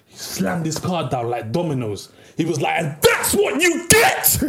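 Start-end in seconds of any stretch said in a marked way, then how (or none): 2.8-4.4 high, drawn-out triumphant shout; going ballistic. Retold or imitated. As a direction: slam it down as a long retold victory yell. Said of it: "he started going ballistic".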